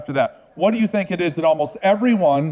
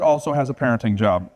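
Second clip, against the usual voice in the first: ordinary talk, nothing marked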